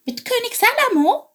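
high sparrow voice. Acted. Chilp interrupts eagerly.

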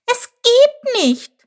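very high, slow little lament; disappointed. A narrator's reading. Chilp gives up hope of paradise, high, slow and disappointed. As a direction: draw it out, high and dejected. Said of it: "flüsterte Chilp enttäuscht".

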